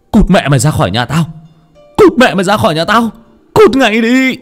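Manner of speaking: rising high frantic shouting; crazed yell. Acted. Drugged son screams at the family to get out, rising and frantic. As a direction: drive everyone out like a madman.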